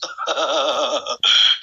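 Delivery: low rattling laugh; staccato cackle. Real, not acted. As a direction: laugh low in rapid, even bursts. Taunts rivals during live battle. (ضحكة منخفضة متقطعة)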